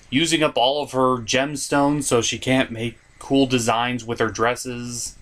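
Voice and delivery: usual speaking voice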